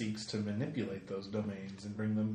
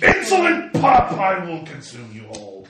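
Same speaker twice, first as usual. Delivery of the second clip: booming furious dragon roar; roaring crackling fire voice; 4 semitones higher, 9 dB louder than usual. Acted. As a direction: roar it like a raging fire god. Dragon god threatens the party.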